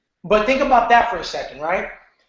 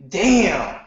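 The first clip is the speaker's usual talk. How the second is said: exasperated email reader; 5 semitones higher, much slower than usual. Retold or imitated. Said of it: "someone's gonna say".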